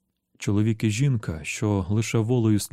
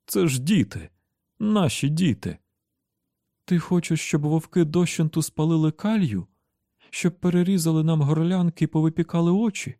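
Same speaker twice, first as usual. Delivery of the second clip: higher-pitched, agitated character plea; agitated protest; 7 semitones higher than usual. Acted. Tian protesting that wolves will take their children, agitated. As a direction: argue urgently, voice lifted. Acted quarrel over taken children.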